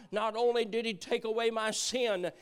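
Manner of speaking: ordinary talk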